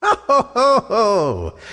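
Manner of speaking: high, booming ho-ho of a giantess; menacing storybook cackle; terrible laugh. A narrator's reading. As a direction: laugh wickedly in slow, pitched hos. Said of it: "she laughed terribly".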